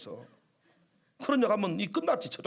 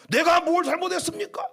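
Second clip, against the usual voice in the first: raised, offended complaint, higher pitch; indignant protest; 6 semitones higher, 3 dB louder than usual. Retold or imitated. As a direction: protest like a wronged pastor. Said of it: "이렇게 한 것이 아니고".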